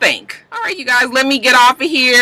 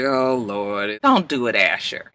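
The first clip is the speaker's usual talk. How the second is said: low, hushed dread; dismayed aside; 10 semitones lower, 7 dB quieter, slower than usual. Retold or imitated. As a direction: murmur a weary warning under your breath. Her dismayed reaction to Asher.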